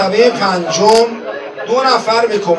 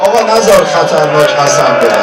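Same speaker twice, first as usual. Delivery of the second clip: loud, higher pleading lament; impassioned sermon cry, louder and higher; full-force preaching; 5 semitones higher, 9 dB louder than usual. Acted. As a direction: cry out the oaths to the crowd.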